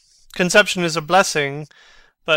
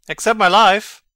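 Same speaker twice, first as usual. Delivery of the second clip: louder, leaning on life; loud outburst; 5 semitones higher, 9 dB louder than usual. Acted. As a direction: fling out the words at full voice. Mad Hamlet repeats the phrase.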